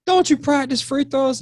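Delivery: mock high-pitched fan voice; heckling fan. Fan heckling the coach from right behind, mock high-pitched voice. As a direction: imitate a nagging fan up high.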